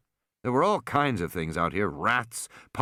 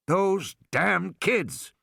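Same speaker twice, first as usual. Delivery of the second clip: angry curse; 6 semitones higher, much slower than usual. Acted. Character curses in story.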